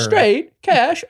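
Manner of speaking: high showy catchphrase shout; hype announcer call. Acted. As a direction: belt the catchphrase like a host. Calling out segment name.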